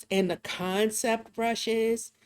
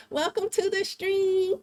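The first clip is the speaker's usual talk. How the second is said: squeaky high welcome; bright high-pitched streamer voice; high-pitched greeting; 11 semitones higher than usual. Retold or imitated. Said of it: "she'll be like".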